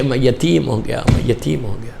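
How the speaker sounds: low, subdued mourning, lightly acted; retold lament. Children mourn their dead father, understated retold lament.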